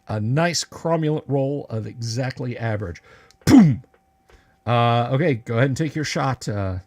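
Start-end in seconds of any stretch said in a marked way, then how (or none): 3.5-3.8 loud, high mouth-made boom; sudden vocal blast sound effect; imitated boom. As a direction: punch out an explosion noise. Game master voices a hit.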